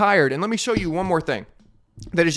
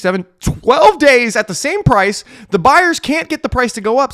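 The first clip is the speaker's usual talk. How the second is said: excited counting; 7 semitones higher, 9 dB louder than usual. Real, not acted.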